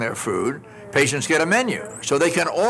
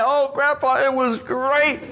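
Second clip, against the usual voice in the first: granddaughter's voice; 7 semitones higher than usual. Retold or imitated.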